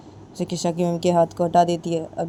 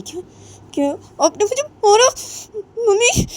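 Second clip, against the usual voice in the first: put-on high, loud, hurt young woman's voice; hurt daughter-in-law; 5 dB louder than usual. Daughter-in-law asks her mother-in-law, high and wounded. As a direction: cry out in disbelief at being hit.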